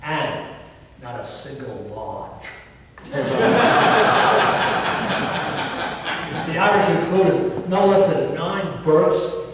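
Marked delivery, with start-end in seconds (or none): 3.0-6.4 hearty laugh. Real, not acted. Laughs at his own punchline.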